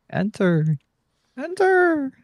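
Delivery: high, slow seductive whisper-tease; teasing chart voice. Pretend. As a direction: tempt them to enter, drawn out.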